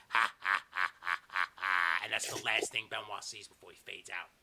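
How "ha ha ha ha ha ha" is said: low, spaced-out mock cackle; hammed-up deep gloating ha-ha; slow triumphant villain laugh; villain's evil laugh. Villain gloats as victim fades out.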